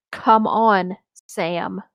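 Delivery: plain reading voice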